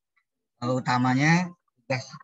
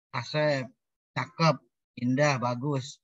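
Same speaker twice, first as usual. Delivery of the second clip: ordinary talk, nothing marked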